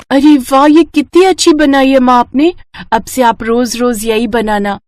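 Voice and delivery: put-on high, excited child's praise; delighted little daughter. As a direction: gush to mother like a thrilled child. Daughter tastes dish and is delighted. (खुशी से चहकती बेटी)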